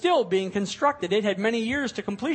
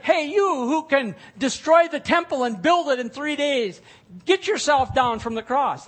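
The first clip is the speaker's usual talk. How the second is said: loud, higher jeering; mocking taunt; 7 semitones higher, 8 dB louder than usual. Mockers jeering at Jesus on the cross, loud taunting. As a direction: sneer it up at the cross.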